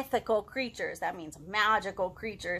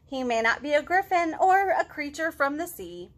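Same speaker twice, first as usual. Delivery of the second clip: lilting rhyming picture-book read-aloud; reading to children; 4 semitones higher than usual. A narrator's reading. Teacher reading a rhyming story to kids, lilting storytime voice.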